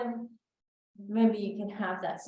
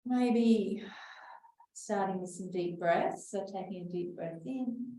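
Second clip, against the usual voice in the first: guided breathing. Acted. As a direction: talk the group calmly through each breath.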